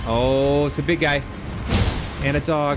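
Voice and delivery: loud, high alarmed outburst. Real, not acted. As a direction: shout in alarm at the big guy. Big enemy and dog appear.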